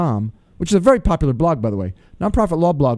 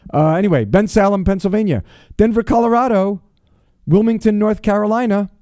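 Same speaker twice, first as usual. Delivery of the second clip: high, louder upbeat shout-outs; radio shout-outs; 7 semitones higher, 4 dB louder than usual. Acted. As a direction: call out each city with a showman's lift. Shout-outs to listener cities.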